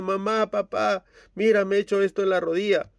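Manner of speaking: voz alta de niño quejoso, rápida; niño que enseña su rodilla herida, agudo; niño llorando. Acted. (niño lastimado que se queja con sus padres, agudo)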